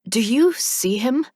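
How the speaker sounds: urgent. A narrator's reading. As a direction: press him for an answer. Mila urgently asks Silas.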